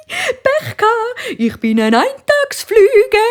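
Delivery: fly mocking the spider, slow and high with laughter; laughing fly. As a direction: drag it out smugly, laughing through it. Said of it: "hat die Fliege gelacht und gesagt".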